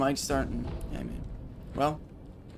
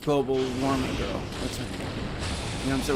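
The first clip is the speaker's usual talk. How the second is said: usual speaking voice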